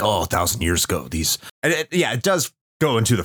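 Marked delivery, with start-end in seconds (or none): 0.0-1.4 mock dramatic narrator. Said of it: "they try to be really dramatic".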